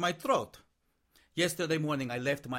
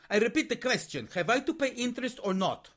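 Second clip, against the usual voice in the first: demanding, pitched higher; insistent; 7 semitones higher than usual. Acted. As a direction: press the question hard. Insistent creditor repeating demand.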